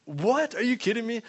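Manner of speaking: freaking out. Retold or imitated. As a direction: act out girls freaking out in amazement. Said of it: "they both started freaking out".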